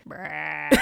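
low, soft rolled brrr like a night bird; bird trill imitation. Imitating weird bird noises.

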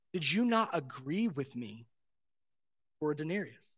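soft, quiet, patient asking; gentle question. Retold or imitated. Master to grumbling laborers, gently. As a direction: ask it kindly, low and soft.

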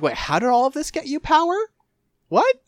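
high-pitched incredulous questioning; hammed-up confusion, pitch way up; retold bafflement. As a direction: voice shooting up in disbelief. Retells own bafflement at villain's plan.

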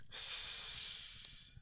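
long voiceless hiss. A narrator's reading. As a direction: hiss like an angry creature. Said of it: "hissed Gollum".

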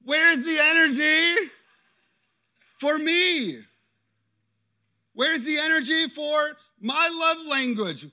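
husband shaking his fist at his wife, mock shouting; mock angry shout. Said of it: "Shake your fist".

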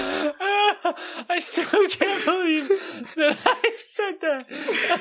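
very high, slow laughing squeak; drawn-out, wheezy disbelief; weak with laughter. Real, not acted. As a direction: squeeze the words out through laughter. Still laughing at own blunder.

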